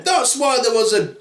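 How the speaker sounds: high-pitched revolted outburst; Daddy Bear. Acted. Father bear realising about his toothbrush, disgusted. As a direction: wail in mock disgust. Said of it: "said Daddy Bear".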